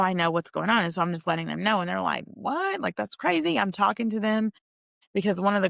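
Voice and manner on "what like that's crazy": shocked disbelief. Retold or imitated. Girls react to fraud story.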